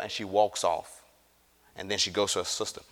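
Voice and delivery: ordinary talk, nothing marked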